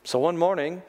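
ordinary talk

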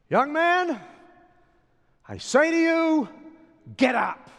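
high, loud command; commanding call. Acted. Jesus calling the dead young man, loud command. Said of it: "He said".

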